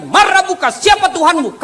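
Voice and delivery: high, loud interrogating angel; stern angel voice. Acted. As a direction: question the dead like a fearsome angel. (suara malaikat yang menginterogasi dengan keras)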